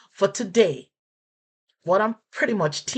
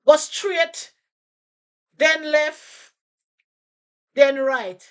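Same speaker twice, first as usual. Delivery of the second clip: high, loud Jamaican patois reply; 9 semitones higher, 4 dB louder, slower than usual. Shown as a demonstration. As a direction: answer like a local giving directions in patois. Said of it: "the Jamaican person might respond to you and say".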